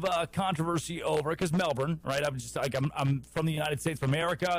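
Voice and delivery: ordinary talk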